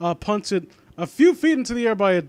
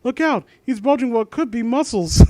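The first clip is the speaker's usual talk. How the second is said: cartoon quote voice; 6 semitones higher, 6 dB louder than usual. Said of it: "that line from Futurama".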